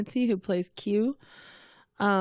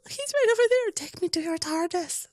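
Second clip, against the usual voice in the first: high, breathless fan squeal; starstruck squeal; 10 semitones higher than usual. Retold or imitated. Convention fan spotting the Doctor, high giddy squeal. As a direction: gush at the star. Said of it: "everybody else is gonna squeal".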